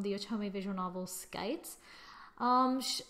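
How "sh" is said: usual speaking voice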